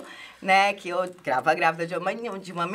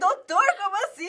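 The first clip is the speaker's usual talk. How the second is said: shocked disbelief; 11 semitones higher than usual. Retold or imitated. Retold shock at the news.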